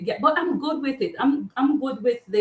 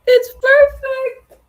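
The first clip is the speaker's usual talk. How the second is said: shrieking it's perfect with glee; high excited squeal; 8 dB louder, much slower than usual. Real, not acted. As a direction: sing it out high and loud, thrilled. Squeals to viewers, delighted.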